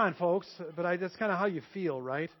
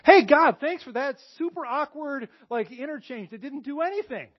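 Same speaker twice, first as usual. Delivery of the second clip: higher, mock grateful complaint to God; sarcastic thanks; 8 semitones higher, 4 dB louder than usual. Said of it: "And I was like".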